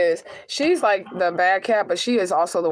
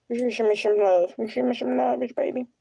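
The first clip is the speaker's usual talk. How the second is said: cooing; 5 semitones higher than usual. Real, not acted. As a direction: coax the cat gently. Cooing to cat.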